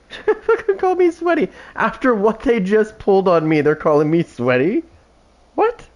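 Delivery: high, laughing disbelief; incredulous laughter. Real, not acted. Player amazed at being called sweaty, real incredulous laughing. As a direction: burst out laughing, pitch shooting up in amused outrage.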